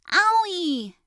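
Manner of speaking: put-on a fallen girl screaming in pain. A narrator's reading. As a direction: yelp as she hits the floor. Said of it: "she screams".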